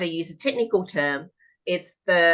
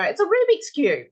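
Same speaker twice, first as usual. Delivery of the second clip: put-on higher, louder child answer; 11 semitones higher, 5 dB louder than usual. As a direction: answer like an eager kid. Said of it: "So they go".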